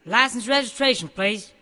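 stern cop. Acted. Cop demands papers.

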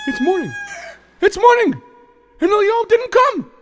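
high, loud cry of dismay; shocked dismay. Acted. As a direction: wail it in disbelief. Waking up, guest never came.

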